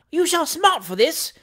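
high-pitched, indignant gasp; gasped threat. A narrator's reading. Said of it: "gasped Mister Pickwick".

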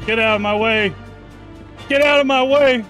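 ordinary talk

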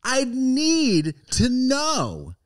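mock dramatic. Dramatic slow question to guest.

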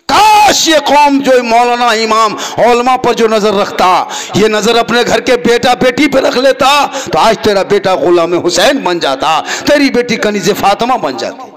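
slow, loud fervent sermon cry; full-force preaching. Acted. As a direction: thunder it from the pulpit. Preacher rebukes the community. (ऊँची आवाज़ में जोशीली तक़रीर)

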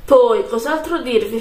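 ordinary delivery

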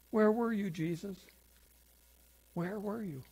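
hushed, grieving plea; soft pleading. Acted. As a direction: ask it quietly into the silence. Voicing the faithful's unanswered prayers.

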